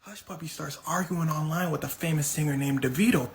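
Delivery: usual speaking voice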